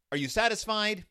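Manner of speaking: snappy impatient question; mock exasperated God. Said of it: "And so God's like".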